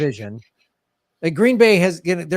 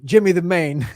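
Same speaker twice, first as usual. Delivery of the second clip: mock East Coast accent. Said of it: "they'll call you Jimmy the Maine".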